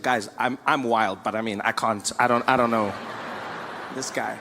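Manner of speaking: low, hesitant mock dictator; a touch of a nervous strongman; lightly voiced Kim Jong-un.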